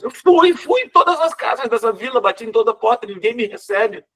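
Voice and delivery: high, loud, pleading protest; desperate high cry. Acted. Jean Valjean protesting to the woman, high desperate cry. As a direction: cry out that every door was tried.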